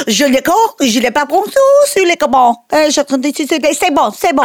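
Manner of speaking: put-on improvised gibberish French accent; playacted French speaker; mock French. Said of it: "another day I was like".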